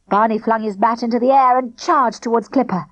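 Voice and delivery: ordinary delivery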